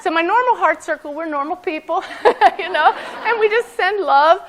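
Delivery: high, loud and laughing through the words; amused, laughing. Real, not acted. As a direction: joke with the audience and break into laughter. Joking contrast, laughing as she talks.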